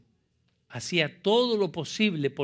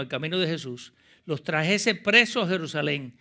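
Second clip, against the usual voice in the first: loud, raised-pitch dramatic Bible reading; preacher reading scripture; 4 semitones higher, 8 dB louder than usual. Acted. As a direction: build the reading with mounting force.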